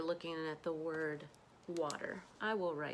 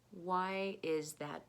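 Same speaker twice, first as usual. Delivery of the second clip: usual speaking voice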